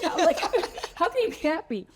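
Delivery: high, laughing mock exasperation; retold annoyance. Patient baffled by her happy surgeon, laughing mock annoyance. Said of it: "I was like, so annoyed".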